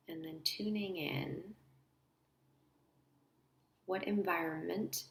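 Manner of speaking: soft, unhurried guide with long pauses; quiet guided-visualization voice; guided meditation. Acted. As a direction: lead them gently inward, leaving space between phrases.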